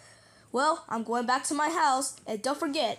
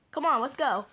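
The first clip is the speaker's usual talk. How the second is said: ordinary talk, nothing marked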